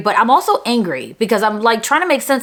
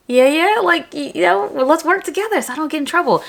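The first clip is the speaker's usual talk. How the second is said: higher-pitched, eager-to-please bubbly chatter; 7 semitones higher than usual. Retold or imitated. Student joking with the principal to avoid trouble, bubbly. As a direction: play the chirpy good girl talking her way out. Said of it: "trying to be my bubbly self".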